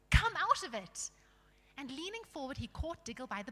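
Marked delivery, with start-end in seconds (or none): 0.1-1.1 loud, higher command; cried out. A narrator's reading. Tyrion ordering the dwarf out, loud cry. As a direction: call it out sharply. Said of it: "cried Tyrion".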